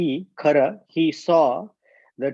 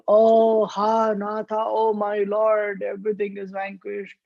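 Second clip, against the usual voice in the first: mocking hue and cry; 8 semitones higher than usual. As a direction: mimic someone wailing, high and stretched out. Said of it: "making such a big hue and cry".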